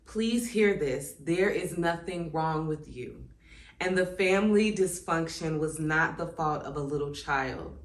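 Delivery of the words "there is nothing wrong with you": ordinary talk, nothing marked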